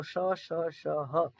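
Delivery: "श" hissed out with air pushed over the hand; breathy sibilant demo. Shown as a demonstration. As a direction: push warm air out on श, श, श, then ह. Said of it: "हाथ आगे रखो मुंह के पास और बोलो".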